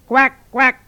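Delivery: high croaking frog call. Acted. Frog calling to the guard, croaky and high. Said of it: "fit la grenouille".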